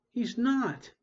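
high, loud protest; cry. A narrator's reading. Derek crying out to Lyle, tugging his shirt, high and loud. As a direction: cry it out in defense. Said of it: "Derek cried".

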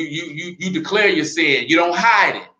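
usual speaking voice

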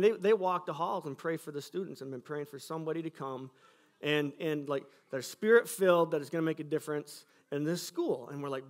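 ordinary delivery

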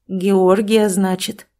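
plain reading voice

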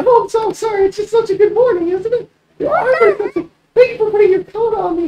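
put-on very high girlish falsetto; chirpy morning-person girl; cheery, apologetic girl. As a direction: gush sweetly in a high-pitched voice. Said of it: "She's in a surprisingly good mood today".

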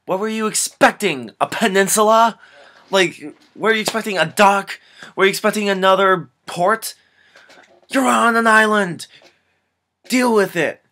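loud, high mocking disbelief; exasperated rant. Real, not acted. Reviewer ranting at the movie hero, loud and high.

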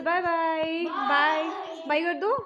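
waving goodbye to viewers; cheerful goodbye. Real, not acted. Teacher saying bye to YouTube viewers, loud and cheerful.

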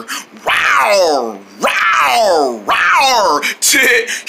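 long rawrs climbing in pitch; tiger roars. Acted. [name] pretending to be a tiger, roaring. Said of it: "he's pretending to be a tiger".

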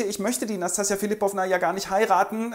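a hint of a whiny Ganja; pleading Ganja. Ganja lässt ausrichten, Figurenstimme.

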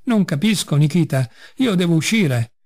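plain reading voice

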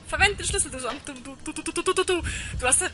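stammering, rising to a loud, high cry; frantic. Real, not acted. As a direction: panic stuttering at the game. Frantic at the locked door.

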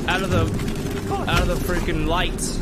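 frantic fleeing, voice up; being chased. Real, not acted. Fleeing in horror game.

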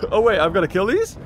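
usual speaking voice

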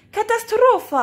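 a loud comic tuba groan; tuba's big voice. Acted. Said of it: "grogna le tuba d'une grosse voix".

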